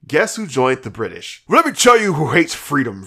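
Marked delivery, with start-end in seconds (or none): 1.5-3.0 mock conservative pundit voice, a bit higher and louder; hammed-up talk-show conservative. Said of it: "I said that in a conservative voice".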